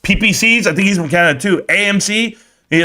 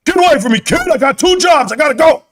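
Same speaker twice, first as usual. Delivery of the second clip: high, raised-voice brush-off; imitated irritated dad; 9 semitones higher than usual. Woken dad to his pestering kid, mock angry yelling. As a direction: act out a harried father yelling the kid away. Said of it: "My pops woke up, and he was like".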